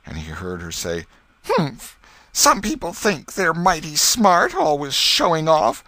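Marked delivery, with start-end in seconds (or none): 1.5-5.8 high, loud and sniffy, nose in the air; a proud girl snubbing a show-off, much higher and louder; haughty schoolgirl. A narrator's reading. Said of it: "with her nose in the air".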